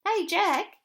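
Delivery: eager hello to a teammate; friendly hey. A narrator's reading. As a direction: call out cheerfully to a new friend. Jim greets Jack loudly.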